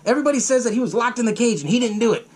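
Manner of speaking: high-pitched pleading objection; mock exasperated protest. Police tell angry Axel they can't, protesting. Others protest the order to arrest.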